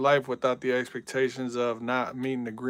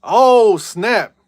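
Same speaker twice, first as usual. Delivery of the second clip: much higher, louder sudden yelp; surprised outburst; 8 semitones higher, 13 dB louder than usual. Real, not acted. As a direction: caught off guard by the play, shout it. Sudden game play surprise.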